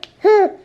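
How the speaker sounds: sudden loud laugh, up high; high burst of laughter. Real, not acted. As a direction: let a bright laugh burst out. Mom laughing at baby.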